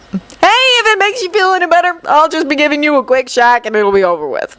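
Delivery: high, quick cocky guy; breezy cocky reassurance; confident. Acted. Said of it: "Kaminari said confidently".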